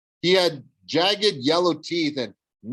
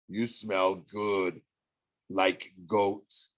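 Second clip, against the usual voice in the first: low, slow, softer monster purr; menacing cyclops; 5 semitones lower, 4 dB quieter, much slower than usual. A narrator's reading. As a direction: savor each word like a hungry giant.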